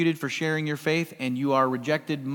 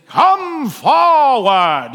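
high, very loud, drawn-out booming call; booming wizard voice; 9 semitones higher, 10 dB louder, much slower than usual. Acted. Wizard summons Dorothy and the gang, booming. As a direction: boom it out slowly like a mighty wizard. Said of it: "he's got this booming voice".

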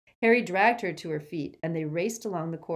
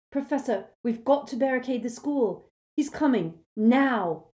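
higher, louder urgent plea; urgent Harry; 7 semitones higher, 6 dB louder than usual. Acted. Harry warning the teachers, urgent and loud. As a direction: warn them, pressing hard.

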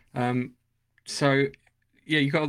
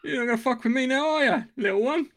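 higher mock-menacing Khan voice; Khan lifting Chekhov; 8 semitones higher than usual. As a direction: tease him like a small child you've lifted, in Khan's voice. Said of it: "he just picks him up and says".

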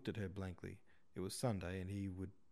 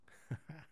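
laugh; 8 semitones higher, 5 dB quieter than usual. Real, not acted. Reader amused by the line.

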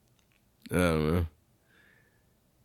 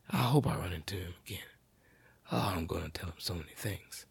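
whispered grudge; 9 dB quieter, faster than usual. Real, not acted. Muttering revenge.

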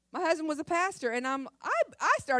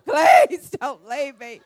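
high, loud, slow wailing plea, laughing through it; mock crying plea; 4 semitones higher, 10 dB louder, much slower than usual. Wife waking husband, begging him not to leave, mock sobbing. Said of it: "I would just start crying".

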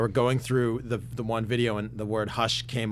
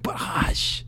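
whisper; 8 semitones higher than usual. Retold or imitated. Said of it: "I'm like".

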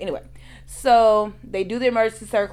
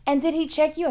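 high-pitched nurse; 10 semitones higher, faster than usual. Retold or imitated. Nurse asks the patient, high and brisk. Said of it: "and she said".

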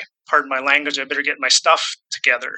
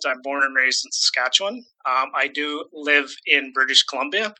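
normal talking voice

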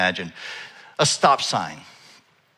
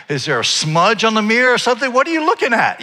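high, put-out mock challenge; indignant imagined retort; 7 semitones higher, 4 dB louder than usual. Preacher imagining his retort to the staring stylist, indignant. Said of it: "I didn't say that, but".

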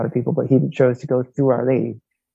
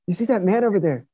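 higher pitch, urgent confidential warning; 7 semitones higher than usual. Retold or imitated. Coworker pointing out the atheist, urgent raised warning. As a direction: warn the priest about his coworker. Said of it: "He says".

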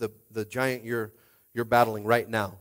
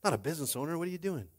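higher-pitched exasperated dad; imitated dad; 6 semitones higher than usual. Dad warning his child off becoming a pastor, higher exasperated voice. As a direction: voice a dad objecting, pitch climbing as he goes. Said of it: "he was like, when my dad told me".

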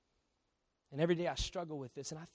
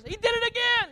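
very high, loud, indignant outburst; hammed-up outraged tattling; angry complaint; 10 dB louder than usual. Angry customer complaining to the owners, hammed-up outrage. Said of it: "The man's so angry".

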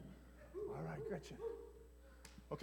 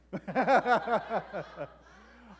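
loud open laughter; hearty laugh; 7 dB louder than usual. Real, not acted. Laughing after game.

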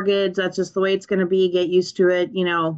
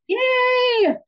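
excited shout. Real, not acted. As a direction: cheer it back with real glee. Guest cheers back loudly.

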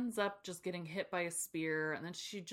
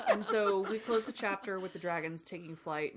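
ordinary talk, nothing marked

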